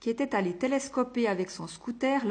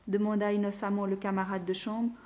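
plain reading voice

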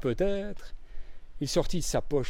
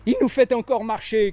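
high, loud cry of realization; cried out; 8 semitones higher, 5 dB louder than usual. A narrator's reading. Bridget crying out to Poirot, loud and high. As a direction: call it out, she's caught on. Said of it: "cria-t-elle".

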